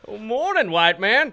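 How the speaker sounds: high, loud mock hail; called-out greeting. Hailing the disguised captain from afar, hammed-up holler. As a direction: call it out for comic effect. Acted hail between ships.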